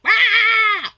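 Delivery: high, loud imitated shriek; mock scream. Host mimicking the overreacting seller, mock scream. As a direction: let out an overblown tantrum scream. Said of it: "was necessary to be all like".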